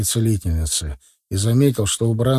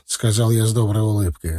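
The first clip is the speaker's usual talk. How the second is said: plain reading voice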